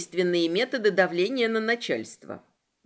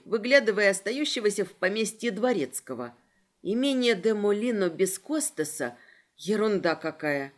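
ordinary delivery

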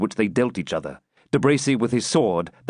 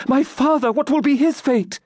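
extremely high, frantic wail; desperate, high-pitched lament; escalating anguish; 4 dB louder than usual. A narrator's reading. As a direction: let dread for a parent peak. Rebecca's fear for her father mounts.

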